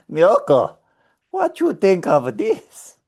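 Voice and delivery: high, slow put-on Japanese accent; mock Japanese accent. Husband asks his wife about the ad, mock Japanese accent. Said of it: "like, a Japanese accent".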